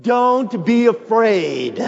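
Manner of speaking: high, loud and slow, every word leaned on; preacher leads the congregation in a loud, slow declaration; like you mean it. Shown as a demonstration. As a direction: declare it with full conviction. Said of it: "Say it like you mean it".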